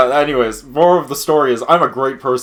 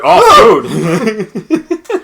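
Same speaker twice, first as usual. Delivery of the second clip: mock excited cat; 6 semitones higher, 5 dB louder than usual. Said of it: "And the cat will be like".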